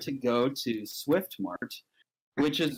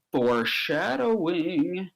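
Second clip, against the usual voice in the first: higher, slow singled-out word; knowing aside; 5 semitones higher, slower than usual. Acted. Storyteller flags a plot hint, knowingly. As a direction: draw it out as a heavy hint.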